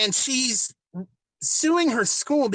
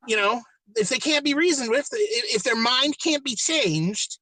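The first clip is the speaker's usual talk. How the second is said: higher, earnest do-gooder voice, half-mocking; mock idealist liberals; 7 semitones higher than usual. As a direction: pitch it up like naive zealots selling a murder plan. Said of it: "a couple of 'em decide".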